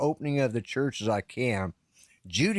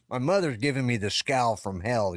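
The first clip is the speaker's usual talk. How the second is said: usual speaking voice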